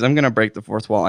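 ordinary talk

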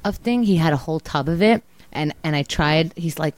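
usual speaking voice

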